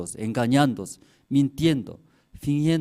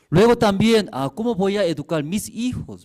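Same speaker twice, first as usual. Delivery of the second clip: loud preaching question; 8 semitones higher, 6 dB louder than usual. Acted. Preacher rising rhetorical question.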